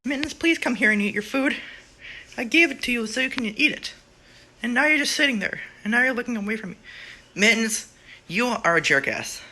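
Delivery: loud, frustrated telling-off; exasperated scolding. Real, not acted. Owner yelling at her cat to eat its food.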